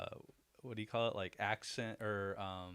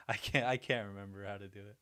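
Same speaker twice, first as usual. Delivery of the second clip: ordinary talk, nothing marked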